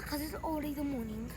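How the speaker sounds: ordinary talk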